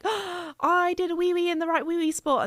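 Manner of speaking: high-pitched thrilled puppy voice after a gasp; gasp, then squeal it like a proud little puppy; excited puppy. Acted. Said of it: "he gets very excited 'cause he's like".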